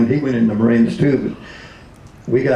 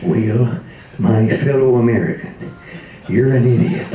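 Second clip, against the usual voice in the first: Ronald Reagan. Retold or imitated. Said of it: "called up Ronald Reagan".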